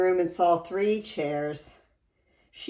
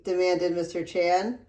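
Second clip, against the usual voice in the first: plain reading voice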